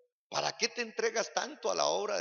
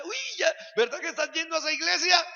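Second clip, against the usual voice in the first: higher, fast, teasing; mocking friend; 9 semitones higher, 7 dB louder, faster than usual. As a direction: tease them about church. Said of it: "las amigas le dan".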